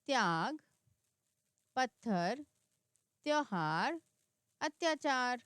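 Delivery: each word alone, clear and firm; word-list reading. Shown as a demonstration. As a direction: model reading for children. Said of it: "आधा अक्षर इस तरह से पढ़ें".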